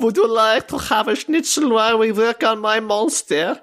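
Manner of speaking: put-on mad scientist German accent; playful German accent, laughing. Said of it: "give us a good German accent".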